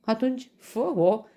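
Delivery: high, loud amused dare; merry chuckle. A narrator's reading. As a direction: dare him gleefully. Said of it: "chicotește vesel leul". (chicotind vesel)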